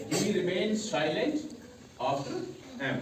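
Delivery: drawn-out, deliberate recitation of the rule; slow recitation. Shown as a demonstration. Teacher repeating the rule to the class, slowly and deliberately. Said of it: "हमने यहाँ क्या बोला?".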